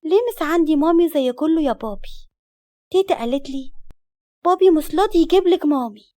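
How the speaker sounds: put-on high, childlike questioning; little girl voice. A narrator's reading. Small daughter asking daddy for a mommy, childlike. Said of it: "أم لسه على شعرها فقالت".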